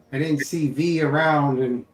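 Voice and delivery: ordinary talk, nothing marked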